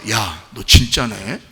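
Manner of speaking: low, slow, hushed admiration; impressed realization. Acted. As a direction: say it slowly, quietly impressed. Companion impressed by genuine faith. (낮고 느리게 감탄하는 말투)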